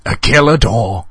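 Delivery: hammy evil-door voice; cackling villain. A narrator's reading. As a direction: announce it with villainous glee. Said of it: "cackled the door".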